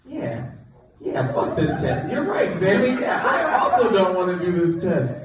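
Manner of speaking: high, giggling mock agreement; laughing agreement. Class clown agreeing with Sammy, laughing and high. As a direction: egg him on through laughter. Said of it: "And I'm like".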